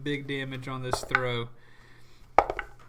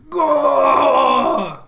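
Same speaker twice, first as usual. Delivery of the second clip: loud, high, drawn-out creature yell; monster yell; 10 semitones higher, 15 dB louder than usual. Acted. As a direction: yell it out long as the enraged monster. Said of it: "he just yells again".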